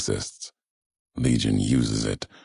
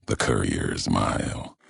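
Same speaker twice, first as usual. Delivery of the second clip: ordinary talk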